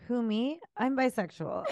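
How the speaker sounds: coy shirt voice. Retold or imitated. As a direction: play it coy and innocent. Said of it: "You're like, it says".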